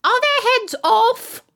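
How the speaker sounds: very high, loud, stretched question; shouted. A narrator's reading. Queen checks on the execution, shouting. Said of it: "shouted the Queen".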